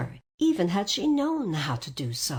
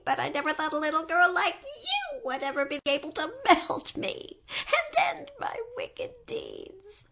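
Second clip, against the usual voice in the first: wailing melting witch; 11 semitones higher than usual. A narrator's reading.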